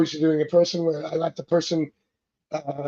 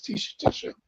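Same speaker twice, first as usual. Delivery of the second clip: urgent whisper for more tissue; whispered plea; 6 dB quieter than usual. Retold or imitated. Reader at a crying party runs out of Kleenex, whispering. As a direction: breathe it out without voice, begging for tissue.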